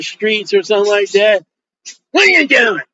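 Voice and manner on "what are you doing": raised, pinched little-lady voice; little lady's challenge. Retold or imitated. Little lady confronting a street preacher, raised put-on voice. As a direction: play the nosy little lady questioning him. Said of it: "This little lady".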